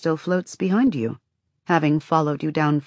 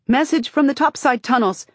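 high, loud call; 9 semitones higher, 4 dB louder than usual. A narrator's reading. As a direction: call out while running up. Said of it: "the boy calls".

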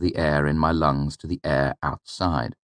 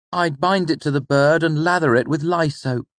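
put-on much higher, keen boy's voice; a higher character voice for the boy; voiced young student Elir; 12 semitones higher, 4 dB louder than usual. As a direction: answer like an eager clever pupil. Young Elir answers his teacher.

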